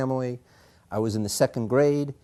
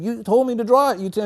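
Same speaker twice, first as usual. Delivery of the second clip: high, loud frightened protest; a scared kid defending himself; boy pulled into the hallway, high frightened plea; pleading child; 12 semitones higher, 7 dB louder than usual. Retold or imitated. Boy fears he's in trouble.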